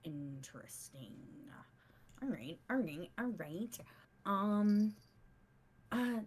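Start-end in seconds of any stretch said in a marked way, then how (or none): 0.0-1.1 soft, breathy intrigued whisper. Acted. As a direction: whisper it to yourself. Player whispers in character.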